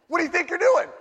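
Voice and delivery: high, loud mock outrage; guard your plate, indignant; possessive snap. Guarding his dessert from wife.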